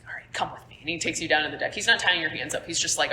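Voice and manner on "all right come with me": soft, low-volume command; quiet urging; hushed order. Acted. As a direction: murmur it so no one hears. NPC quietly leading player away.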